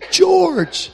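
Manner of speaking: high bright call across the lot; calling out a name. Retold or imitated. Man calling to an old friend across the lot, high call. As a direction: call his name, glad to see him.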